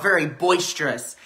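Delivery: ordinary talk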